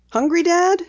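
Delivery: put-on light girl voice for Penny; Penny, lightly voiced. A narrator's reading. Penny telling her dad she'll drive, lightly voiced girl.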